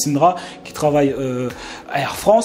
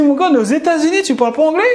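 much higher, mock-outraged question; indignant disbelief; 12 semitones higher, 4 dB louder than usual. Mock outrage at a man who speaks no English in America. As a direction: act shocked, as if scolding him. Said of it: "J'ai dit".

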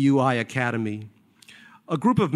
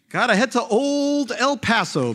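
Barry's voice; 8 semitones higher than usual. Acted. Barry's breezy line.

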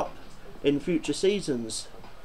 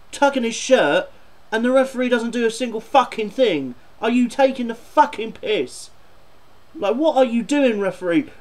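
real anger, louder and higher, shouting at the referee; furious rant; 5 semitones higher, 5 dB louder than usual. Real, not acted. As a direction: tear into the cheating striker and the ref. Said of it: "I'm seriously getting annoyed".

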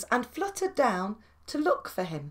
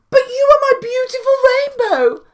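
pleading; 11 semitones higher, 9 dB louder, faster than usual. Acted. Tadpole pleads.

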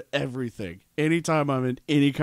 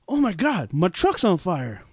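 higher, louder mock panic; 6 semitones higher, 4 dB louder than usual. Driver realising his truck's on fire, mock panic. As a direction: act out the moment the truck catches fire.

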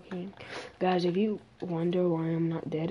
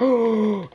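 sharp gasp; 4 semitones higher, 10 dB louder than usual. Real, not acted. Surprised find of parrot.

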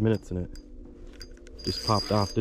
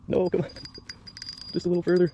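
anxious pleading; 8 semitones higher, faster than usual. Real, not acted. Fish diving away.

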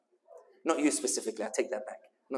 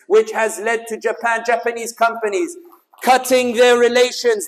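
loud, high rallying oratory, stretching key words; rally the crowd with forceful emphasis; impassioned speech; 6 semitones higher, 10 dB louder, slower than usual. Real, not acted. Speaker urging the crowd that they brought the change, impassioned oratory.

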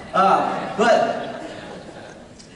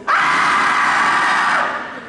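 long high-pitched mock shriek; imitated child's scream; 4 dB louder than usual. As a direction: shriek high like a terrified little girl. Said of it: "Morgan said".

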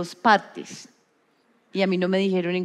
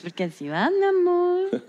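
1 makeup artist imitation; 9 semitones higher than usual. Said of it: "sí habla así".

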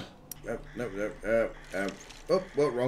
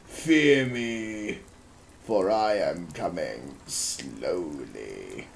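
low, soft, drawn-out threat; slow mock menace; 10 semitones lower, 6 dB quieter, much slower than usual. Player in the world's slowest transport, slow mock menace. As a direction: sink lower and slower as you threaten.

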